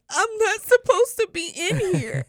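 high, slow, hammed-up crying voice; mock tearful wail. Tearful student to German friend at the bar, mock wailing. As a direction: whine it out as if bawling, played for laughs. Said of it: "I broke into tears".

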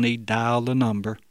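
usual speaking voice

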